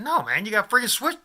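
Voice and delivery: high-pitched retold refusal; alarmed refusal. Storyteller refusing armed man's handshake, acted alarm. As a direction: act out backing off from the handshake.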